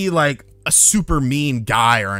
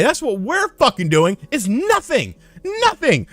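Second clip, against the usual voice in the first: higher, exasperated rant; mock exasperation; 10 semitones higher than usual. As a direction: vent the frustration at her. Angry retort to morning question.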